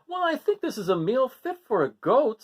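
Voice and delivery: high pleased mother voice; Mother Goat. Acted. Mother praising the meal, high and pleased. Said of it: "said Mother Goat".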